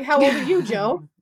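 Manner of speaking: retold indignant comeback; mock retort. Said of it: "And I was like".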